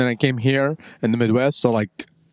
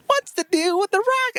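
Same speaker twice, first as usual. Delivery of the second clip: much higher Seinfeld-style observational whine; Jerry Seinfeld impression. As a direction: pitch it up like a sitcom stand-up bit. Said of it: "tried to do, like, an impression".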